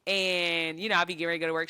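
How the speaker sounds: ordinary delivery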